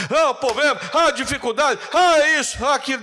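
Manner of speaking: high, loud, mimicked agitated complaining; mock fretting. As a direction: imitate a worrier wailing over every problem. Imita o fiel agitado se lamentando. (imitando lamúria agitada)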